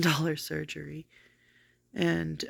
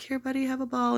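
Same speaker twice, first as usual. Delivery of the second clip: a high, coaxing voice for the dog; a light imitation of her sweet dog-talk; 8 semitones higher than usual. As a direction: coax the puppy gently with a ball. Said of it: "she's like".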